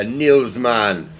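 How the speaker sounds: slow Jamaican accent on "Niels Mann". Real, not acted. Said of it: "I said it in a Jamaican accent".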